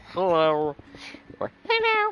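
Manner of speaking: squeaky high cartoon hello; goofy high-pitched character greeting; silly cartoon voice. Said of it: "But I can bloody do".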